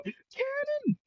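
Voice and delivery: tiny falsetto squeal; very high, soft pretend shout; mock yell. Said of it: "He would yell".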